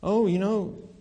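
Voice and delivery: ordinary delivery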